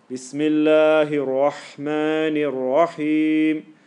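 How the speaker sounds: slow chant with long held vowels; sustained recitation. Shown as a demonstration. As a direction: recite it unhurried in full voice. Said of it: "Say it quietly too sometimes".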